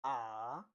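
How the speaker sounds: Mandarin third tone on a long AH, falling then climbing. Shown as a demonstration. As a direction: drop AH down and swing it back up. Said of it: "You fall and rise".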